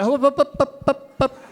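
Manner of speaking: high, loud, insistent up up up. Retold or imitated. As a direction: cut her off loudly like a referee. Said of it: "I went".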